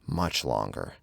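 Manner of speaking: ordinary delivery, nothing marked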